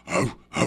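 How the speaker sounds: repeated short animal woofs; imitated bear huffing. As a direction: huff in rhythm like a rocking bear. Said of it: "he starts rocking, going".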